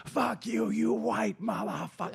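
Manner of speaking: slow, drawn-out mock shout, held back in volume; imitated huge angry man. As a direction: play a humongous man cursing someone out.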